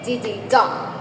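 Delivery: "dididong" as two light beats then a stressed DONG; stress on last syllable. Shown as a demonstration. Teacher sounding out an anapest for the class, stress on the end of "dididong". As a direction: beat out "dididong", landing on DONG. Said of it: "two unstressed syllable followed by a stressed syllable".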